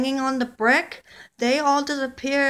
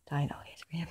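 a hushed secret whisper; 13 semitones lower, 16 dB quieter, faster than usual. Acted. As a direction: whisper it so only your friend hears. Figures confer in secret.

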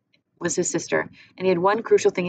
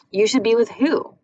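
louder suspicious question; 4 semitones higher, 6 dB louder than usual. Acted. Gretel repeating, pressing.

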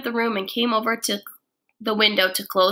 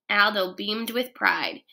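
ordinary delivery

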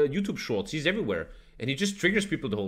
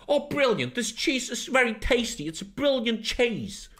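put-on British accent, higher pitched; 9 semitones higher, slower than usual. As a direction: imitate an enthusiastic British guy, pitched up. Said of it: "when you meet British guys, they're like".

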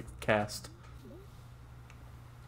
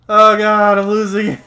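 high, loud panicked yell; panicked shout; 10 semitones higher, 17 dB louder than usual. Real, not acted. Losing the fish.

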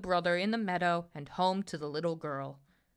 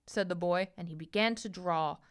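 usual speaking voice

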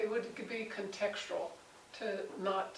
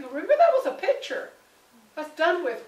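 loud, much higher, forceful reminder; emphatic insistence; 6 semitones higher, 9 dB louder than usual. Retold or imitated. Paul insisting to believers that the law is finished, emphatic. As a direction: drive the point home hard.

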